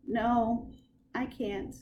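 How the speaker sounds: plain reading voice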